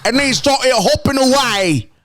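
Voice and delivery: high, stretched mock Australian; hammed-up Australian storyteller; Australian accent. Shown as a demonstration. Demonstrating Australian to friends, high hammed-up Australian accent. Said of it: "That's my Australian".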